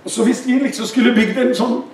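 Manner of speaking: usual speaking voice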